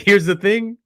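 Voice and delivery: "Here's the thing." lifted up at the end like a teaser; quoting Lee again. Retold or imitated. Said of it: "he's like".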